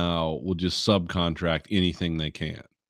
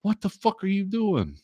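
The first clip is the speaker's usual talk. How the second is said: incredulous outburst; 12 semitones higher than usual. Retold or imitated. Yelling at workers throwing sparks.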